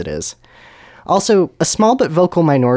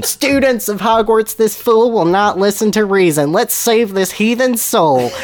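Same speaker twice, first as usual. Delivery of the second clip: high, slow grand proclamation; rallying cry; 9 semitones higher, slower than usual. Acted. Dumbledore rallies students to save a soul, proclaiming grandly. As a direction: exhort the crowd like a zealous preacher.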